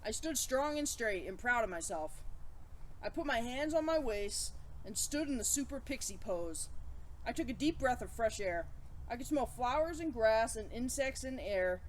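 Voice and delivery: ordinary delivery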